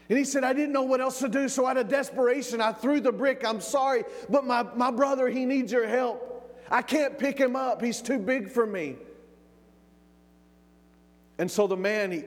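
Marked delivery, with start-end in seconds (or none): 0.4-9.0 high, urgent retold begging; desperate plea. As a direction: beg higher and in a rush of apology. Boy apologising, begging for help.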